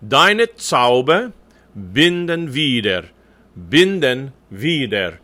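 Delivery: slow "Deine Zauber binden wieder", second syllables light; unaccented final schwas. Shown as a demonstration. Coach modelling for the choir, slow German with weak endings. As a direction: stretch the first syllables, relax each final e to schwa. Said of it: "the E's in the second syllables of those words are unaccented".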